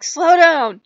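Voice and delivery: high, loud imitated call; mock yell. Companion telling player to slow down, mock yell.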